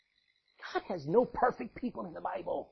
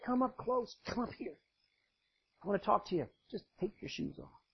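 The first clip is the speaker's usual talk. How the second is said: softer, fading gentle call; tender invitation; 5 dB quieter than usual. Acted. God gently calling Moses up to him. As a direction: coax him closer quietly.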